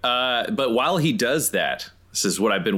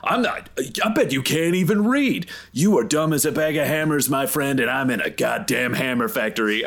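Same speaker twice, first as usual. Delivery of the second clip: higher-pitched, hammed-up needling insults; mocking taunt; 4 semitones higher than usual. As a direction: goad him with playful, raised-pitch mockery. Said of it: "my ability called Sticks and Stones".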